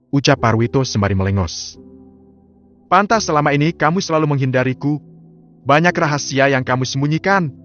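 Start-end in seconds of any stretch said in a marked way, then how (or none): 2.9-7.5 high, cutting replies back and forth; a touch of a scornful wife and cold husband; bitter quarrel. Acted. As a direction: wound each other with every line.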